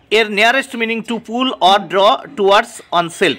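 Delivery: usual speaking voice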